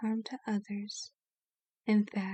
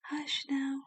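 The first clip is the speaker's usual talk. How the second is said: whispered hush to calm them; soothing whisper to a scared listener; 3 semitones higher, 3 dB quieter than usual. Acted. Soothing a frightened captive.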